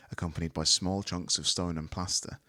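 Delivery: plain reading voice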